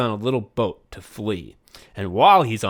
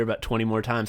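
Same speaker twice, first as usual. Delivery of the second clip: ordinary talk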